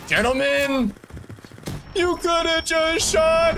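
high squeaky mock shout. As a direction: squeal it to tease a teammate. Mocking a teammate's missed shot.

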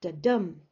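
da-DUM, second beat punched loud; stress on DUM. Shown as a demonstration. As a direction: hit dum hard in Da-dum. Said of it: "It's the exaggerated bit".

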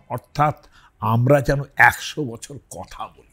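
ordinary delivery

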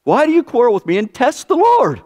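high, pained cry of Moses; exasperated Moses. Retold or imitated. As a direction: plead with the people in dismay. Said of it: "Moses said".

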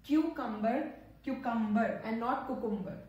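cucumber as "kyoo-cumber", not "koo-cumber"; correct cucumber. Shown as a demonstration. Teacher contrasts cucumber "kyoo" against "koo". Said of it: "the real pronunciation is cucumber".

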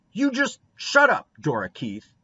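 loud, shrieking-high snap, dropping on the name; fierce. A narrator's reading. Boy goaded to desperation silencing Dora, fierce. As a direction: shout her down angrily. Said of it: "turned fiercely".

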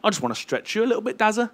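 high, quick, lightly teasing; God's voice. Retold or imitated. God speaking to the preacher, light quick teasing. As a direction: toss it off like a fond nudge. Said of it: "God saying".

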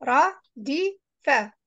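"Radifa" very slowly as ra, di, fa with gaps; teacher's slow, spaced "Radifa"; syllable by syllable. Shown as a demonstration. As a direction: sound out "Radifa" one syllable at a time. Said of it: "read it alphabet by alphabet".

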